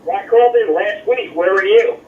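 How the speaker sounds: ordinary delivery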